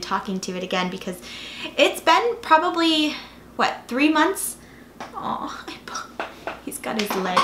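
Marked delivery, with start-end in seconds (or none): 5.1-7.4 whispering. Real, not acted. Whispering over the dog.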